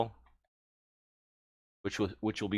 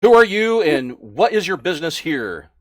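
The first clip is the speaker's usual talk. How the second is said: much higher and louder, demanding; orc guard; 4 semitones higher, 8 dB louder than usual. Acted. Orc guard demanding the newcomer's business, loud. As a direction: challenge the intruder at the gate.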